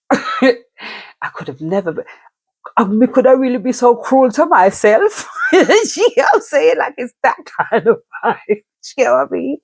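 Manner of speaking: high, loud laughing disbelief. Real, not acted. Speaker laughing at the idea of choosing a cruel life, loud and high. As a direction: laugh through it at the absurdity.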